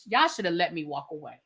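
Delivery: soft, low grumble to himself; muttered complaint. Real, not acted. Grumbling quietly to viewers about not stopping. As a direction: say it under your breath, resigned.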